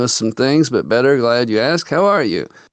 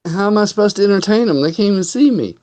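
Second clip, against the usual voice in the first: squeaky, indignant protest; high-pitched protest; 9 semitones higher, faster than usual. Real, not acted. Protesting he can't entertain.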